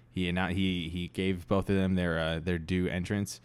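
ordinary delivery

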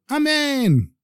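high, drawn-out cry of amen; shouted amen. A narrator's reading. Poor man answering the rabbi's blessing, shouted amen. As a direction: cry it out high, letting the pitch fall away. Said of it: "would shout out".

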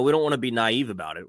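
usual speaking voice

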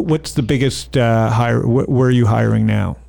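usual speaking voice

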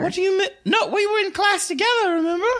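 put-on high-pitched girl, puzzled; Harriet. Harriet reminds Dolly they were in class, high and girlish. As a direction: squeak it in a high schoolgirl voice. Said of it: "Harriet's gonna be like".

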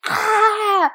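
high overreacting yelp; dramatic yelp. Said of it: "I'll be like".

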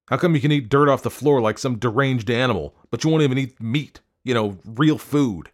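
scornful husband's rant; indignant. A narrator's reading. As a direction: demand an answer in disbelief. Husband berates wife.

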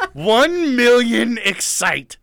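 slow, loud, laughing "One million excite"; laughing boast. Retold or imitated. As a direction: drag it out while cracking up. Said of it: "I go".

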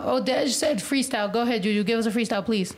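normal talking voice

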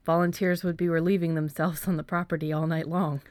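usual speaking voice

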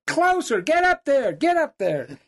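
high, loud egging-on call; retold holler, fading on the last words; urging shout. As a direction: push him closer with a yell. Urging hunter closer.

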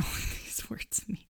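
quick whispered aside with a laugh; whispering. Real, not acted. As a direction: mutter it fast under breath. Aside while reading story.

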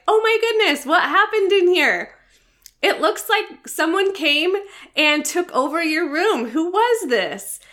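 high, bright-eyed mock astonishment at the messy room; playful cheerful surprise. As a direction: gasp at the room like it's a fun mystery. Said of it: "a positive attitude like".

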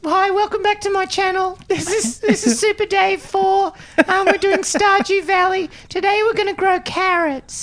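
put-on very high, loud kid gamer voice; kid YouTuber voice. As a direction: play an eager kid YouTuber. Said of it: "He's like".

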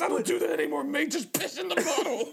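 a hint of Australian pleading; mock pleading. Quoting the tearful urging guy.

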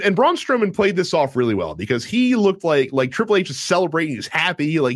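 ordinary talk, nothing marked

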